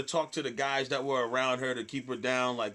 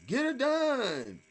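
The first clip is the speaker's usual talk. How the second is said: high, drawn-out mock catchphrase call; imitated heckler yell; 11 semitones higher, much slower than usual. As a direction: whoop it slowly like a tipsy fan. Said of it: "she was like".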